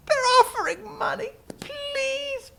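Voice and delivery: squeaky-high pleading voice; hammed-up begging. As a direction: beg like a wheedling child, very high. Balaam begs God for permission.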